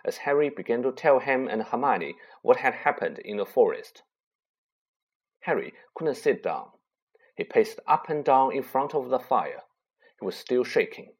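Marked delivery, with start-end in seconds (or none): none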